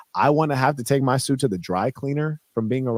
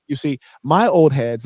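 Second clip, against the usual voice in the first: ordinary talk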